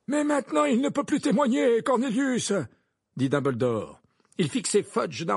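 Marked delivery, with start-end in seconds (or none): 0.1-2.5 high, insistent objection; stern reproach. A narrator's reading. Dumbledore objects to the minister, sternly. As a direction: press the point, pitched up.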